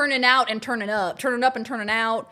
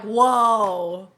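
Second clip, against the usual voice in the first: startled loud whoa, backing off; mock intimidated whoa; 6 dB louder than usual. People reacting to her bad cop look, startled. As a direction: act scared of the bad cop. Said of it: "you're like".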